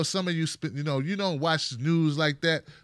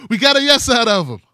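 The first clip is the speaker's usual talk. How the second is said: mock triumph; 6 semitones higher, 12 dB louder, faster than usual. Mock triumph over stapler.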